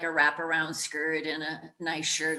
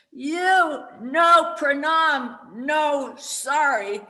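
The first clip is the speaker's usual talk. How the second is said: much higher, louder, very slow barked command; retold yell; 8 semitones higher, 9 dB louder, much slower than usual. Guru shouting at a newcomer in a tent, slow loud bark. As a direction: bellow it slowly like a stern guru. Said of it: "and he yells at me".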